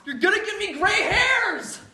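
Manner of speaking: high, loud exasperated yell; freaking out. Acted. Son exasperated at his wild dad, yelling. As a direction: blow up at him in frustration. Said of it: "He's freaking out".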